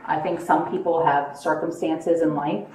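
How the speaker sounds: ordinary delivery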